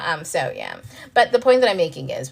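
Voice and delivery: ordinary talk, nothing marked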